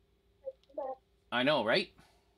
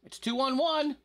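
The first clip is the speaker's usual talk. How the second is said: yelling; 12 semitones higher, 3 dB louder than usual. Real, not acted. Gamer yelling at teammates.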